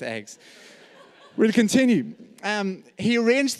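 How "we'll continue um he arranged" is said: laughing. Real, not acted.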